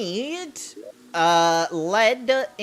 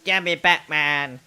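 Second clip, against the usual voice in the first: put-on cute baby voice; 3 semitones lower than usual. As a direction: try Batman, come out babyish. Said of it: "a cute baby voice".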